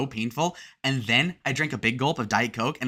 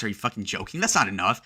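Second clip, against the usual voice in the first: usual speaking voice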